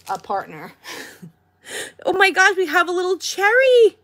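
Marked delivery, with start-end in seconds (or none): 2.1-4.0 excited. Real, not acted. Delighted at finding a cherry toy.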